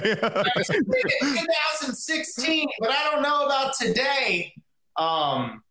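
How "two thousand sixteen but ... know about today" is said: put-on high, slow old lady voice; doubtful grandma, chuckling. Grandma voice reply.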